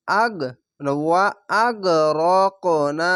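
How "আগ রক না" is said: slow, stretch the final না long; আগ রক না with a long held না; three-alif madd. Shown as a demonstration. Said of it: "চিকন চিহ্ন তিন আলিফ টান". (শেষের না তিন আলিফ টেনে)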